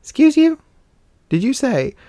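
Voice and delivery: retold in high-pitched mock disbelief; acting out an incredulous "oh, really?"; indignant comeback. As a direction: pitch it way up, affronted.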